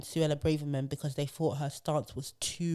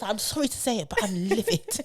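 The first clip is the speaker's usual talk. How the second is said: higher, apologetic posh outrage; posh polite fury; 4 semitones higher than usual. Retold or imitated. Posh MP apologising before his rage, higher. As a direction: say sorry, then livid, all politeness. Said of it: "he was".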